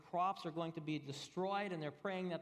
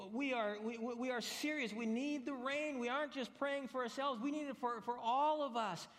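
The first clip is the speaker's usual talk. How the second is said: much higher, urgent and pleading; desperate farmer; 7 semitones higher than usual. Retold or imitated. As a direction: pipe up, desperate for rain. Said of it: "the next farmer pipes up".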